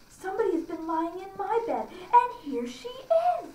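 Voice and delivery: high little bear voice, rising to a shrill high cry; little wee bear. A narrator's reading. Narrator voicing the wee bear discovering the old woman in his bed. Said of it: "in his little small wee voice".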